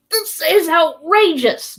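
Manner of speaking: slow, loud and high, fuming; outraged shout. Acted. Harpooner to his companions about the silent crew, shouting. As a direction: bellow it, fed up. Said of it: "Ned Land shouted".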